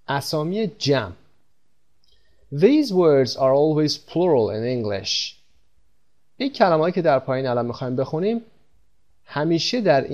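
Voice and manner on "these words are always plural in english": very slow, carefully spaced English sentence; slow model sentence. Shown as a demonstration. Teacher reading the rule aloud for students, very slow. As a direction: spell it out slowly for learners. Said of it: "teacher demonstrating English sentence".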